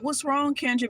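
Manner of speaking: high, sugary fake sympathy; mock concern. Imagined comforting of crying roommate Kendra, fake sweet. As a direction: coo it like you pretend to care. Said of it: "she thought I was gonna be like".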